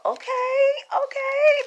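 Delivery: very high squealing okays; excited squeal. Real, not acted. Reading her cards, she squeals with excitement. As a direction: squeal with delight at the card. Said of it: "I'm excited".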